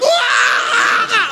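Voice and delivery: high, hammed-up battle yell; cousin's charging scream. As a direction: let out a mock wild yell.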